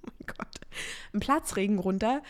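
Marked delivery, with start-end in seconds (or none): 0.0-0.6 laughing whisper. Real, not acted. Laughs at own word mix-up.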